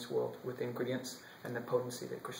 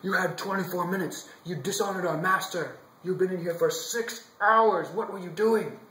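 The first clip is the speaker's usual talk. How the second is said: louder, higher scolding demand; guards' raised, accusing interrogation; angry; 4 semitones higher, 8 dB louder than usual. Acted. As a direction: bark at him like furious guards. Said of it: "they're so angry with him".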